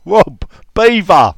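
loud, high call across the office; shout to mate. Retold or imitated. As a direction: shout it out, drawing out the word. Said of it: "I shouted out to my mate".

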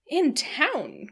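high, loud matron's exclamation; incredulous character outburst; astonished cry. A narrator's reading. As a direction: cry it out in disbelief. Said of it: "cried Missus Jennings".

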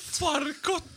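high, soft Finnish "Farkut"; imitated Finnish. Echoing the surprise Finnish translation, high Finnish imitation. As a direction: echo "Farkut" on a rising Finnish lilt. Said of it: "Nu kommer det på finska".

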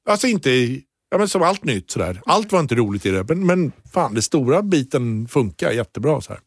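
normal talking voice

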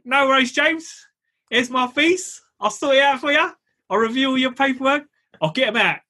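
high-pitched put-on salesman voice; little guy's patter. Landlord services guy reassures James, high put-on voice.

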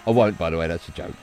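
ordinary talk

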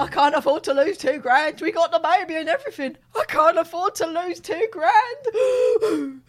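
loud mock sobbing plea; imitating his crying. Imitating the caller begging over lost money, loud mock sob. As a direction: whine it like a man about to cry. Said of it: "This is what he sounded like".

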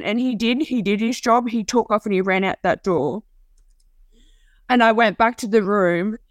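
usual speaking voice

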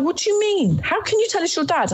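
high, quick astonished question; startled old lady. Retold or imitated. Old landlady, baffled when Emily names the caller, astonished. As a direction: gasp it out in disbelief. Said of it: "And she was like".

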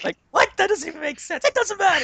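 high squealing laughing voice; squeaky disbelief. Real, not acted. Laughing at the bit.

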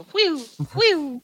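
falling whistle-like whoosh noises; imitated arrow whooshes. Player watching arrows fly past, imitated whooshes. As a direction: make the sound of arrows flying past. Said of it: "watching these arrows go".

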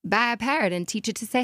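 ordinary talk

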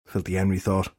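ordinary delivery

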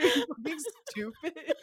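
high, laughing mock mom; laughing imitation of a blunt mom; mom voice through laughter. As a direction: deliver mom's insult while laughing. Mom's blunt reply retold.

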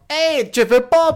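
loud, drawn-out high call; big slow exaggerated heckle-back; calling out to the crowd. Acts out addressing unlaughing audience.

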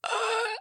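high, strangled "ugh"; horrified gasp, acted. Mom retelling seeing herself in the mirror, mock horrified gasp. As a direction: re-enact a shocked cry at yourself. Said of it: "And I went".

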